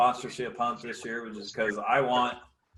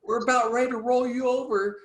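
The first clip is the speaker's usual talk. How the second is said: much higher cocky taunt; imitating Deaver taunting; 11 semitones higher, 4 dB louder than usual. Deaver gloating at the opponent, much higher cocky voice. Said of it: "He goes".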